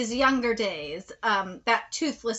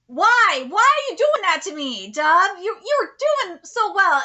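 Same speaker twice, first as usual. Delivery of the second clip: exasperated plea; 5 semitones higher, 6 dB louder than usual. Real, not acted. Scolding the dub.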